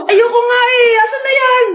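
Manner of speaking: high, whiny sibling refusal; squabbling cry. Acted. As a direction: refuse in a heated high wail. Siblings fight over inherited things.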